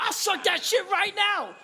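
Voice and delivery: high, loud mock scream; yelling. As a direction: shriek the comeback, hammed up. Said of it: "I'm yelling at him".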